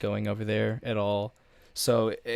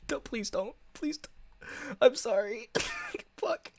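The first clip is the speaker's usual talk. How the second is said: high, squeaky, laughing pleas; half laugh, half cry of panic as it slips out; nervous panicked laugh; 5 dB quieter than usual. Real, not acted. Streamer begs chat not to go to the exposed channel, high nervous laughter.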